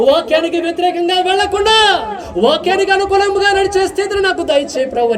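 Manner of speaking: high, ringing prayer voice; impassioned pulpit prayer; fervent prayer. Acted. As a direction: cry out to God for the congregation.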